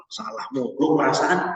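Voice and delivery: low muttered grumble under the breath; a sulky student muttering to herself; grumbling student. Pretend. Student corrected again, grumbling quietly to herself.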